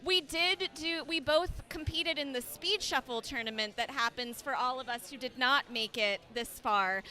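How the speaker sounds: usual speaking voice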